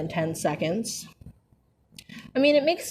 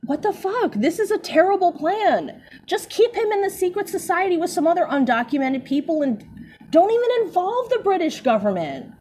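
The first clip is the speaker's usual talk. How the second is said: exasperated rant; 8 semitones higher than usual. Real, not acted. Ranting at terrible plan.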